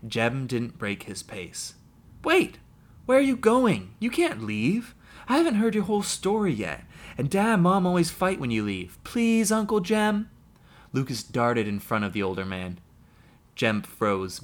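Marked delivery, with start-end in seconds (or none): 2.2-10.3 put-on much higher, louder child begging; young nephew begging his uncle to stay; pleading boy. A narrator's reading. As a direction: call after him, desperate to keep him. Boy begs uncle not leave.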